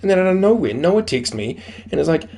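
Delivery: ordinary talk, nothing marked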